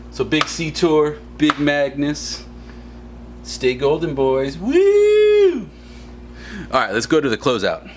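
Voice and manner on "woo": high loud whoop; excited high-pitched woo; cheer. Real, not acted. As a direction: let out a big celebratory woo. Celebratory cheer.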